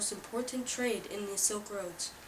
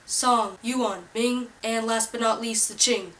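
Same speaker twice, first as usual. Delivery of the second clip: loud, pushy infomercial pitch; hard sell ad; 9 dB louder than usual. Acted.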